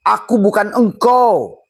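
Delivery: a high, drawn-out voice for the rice. Acted. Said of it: "kata nasi". (suara nasi tinggi dan lambat)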